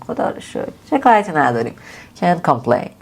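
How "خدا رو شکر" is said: "خدا رو شکر" whispered, resigned; whispered grumble. Shown as a demonstration. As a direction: breathe "خدا رو شکر" under your breath.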